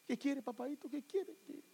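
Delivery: put-on soft, high fussing over a little child; doting parent pleading softly; coaxing parent. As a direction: coo anxiously in a small high voice.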